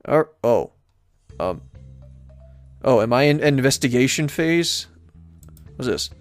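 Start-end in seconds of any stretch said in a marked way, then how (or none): none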